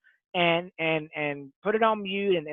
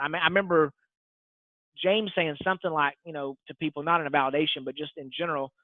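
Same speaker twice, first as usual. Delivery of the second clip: normal talking voice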